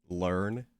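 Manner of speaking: usual speaking voice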